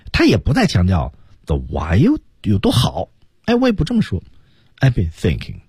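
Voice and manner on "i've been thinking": I've been thinking 从高处慢慢沉下来; falling humble tune. Shown as a demonstration. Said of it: "语调下沉". (语调下沉，谦逊地说)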